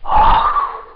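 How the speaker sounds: high, sharp gasp of shock; gasped cry "ah". A narrator's reading. Exclamation in church scene. (высокое испуганное «ах»)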